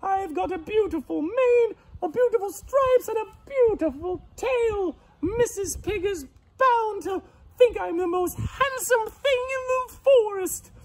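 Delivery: very high, loud piggy squeal; overjoyed pig. Acted. Pig admires himself in the borrowed mane, squealing happily. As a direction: squeal with delight at your new look. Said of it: "he was so happy".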